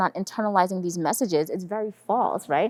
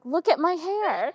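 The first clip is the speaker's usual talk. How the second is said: high, slow, drawn-out child imitation; proud little daughter; 10 semitones higher, slower than usual. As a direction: show off like a delighted toddler. Voices her young daughter showing off.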